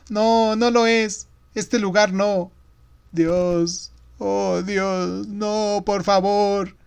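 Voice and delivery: high, drawn-out wail of protest; frightened boy pleading tearfully; trembling child. A narrator's reading. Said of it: "Estaba temblando". (niño aterrado, lloroso)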